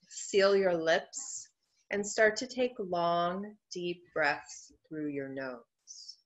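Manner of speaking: slow, measured meditation guidance; guided meditation. Acted. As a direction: ease listeners into stillness, phrase by unhurried phrase. Begins leading the guided meditation.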